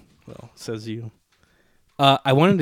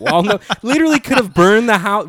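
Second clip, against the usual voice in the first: outraged; 8 semitones higher, 6 dB louder than usual. Real, not acted. Outraged dog story.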